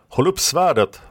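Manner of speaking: high, urgent combat command; roared order. A narrator's reading. Fosse instructs Karlis before the clash, high roared order. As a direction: shout the instruction to a fellow fighter, high. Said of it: "röt Fosse till Karlis".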